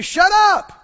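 loud, high shout of shut up; shouted rebuke. Shown as a demonstration. Preacher showing the church how to rebuke doubt, shouting. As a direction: shout them down hard.

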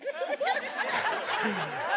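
high, soft gasp of feigned dread; mock frightened gasp. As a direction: gasp as if badly scared. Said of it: "We should go".

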